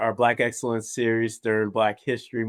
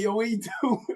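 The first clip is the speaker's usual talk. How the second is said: much higher, disbelieving laughing call; incredulous yo; 10 semitones higher, faster than usual. Retold or imitated. To the guest after the kickoff touchdown, higher amused disbelief. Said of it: "I'm like".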